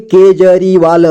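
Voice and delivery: Kejriwal slowly split into syllables. Shown as a demonstration. Teacher breaking down Kejriwal for learners, slowly syllable by syllable. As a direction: sound out Kejriwal slowly, piece by piece. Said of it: "जोड़ जोड़ करके".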